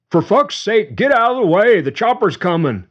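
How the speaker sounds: high, loud angry yell; shouting. Acted. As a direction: bellow it, furious. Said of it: "the man shouted at Leo".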